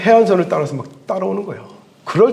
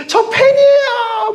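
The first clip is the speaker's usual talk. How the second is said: much higher, slow, squealed fan cry; squealing fan; 4 dB louder, much slower than usual. Acted. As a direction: shriek it like a starstruck fan. Fan crying out to a celebrity. (스타를 본 팬이 높게 지르는 환호)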